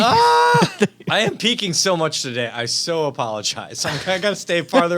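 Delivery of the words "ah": loud high yelp. Real, not acted. Startled outburst right at the mic.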